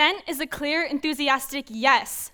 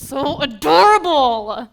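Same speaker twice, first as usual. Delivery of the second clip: slow, louder gush; 6 dB louder, slower than usual. Acted. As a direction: gush over how adorable it is. Gushing about boyfriend.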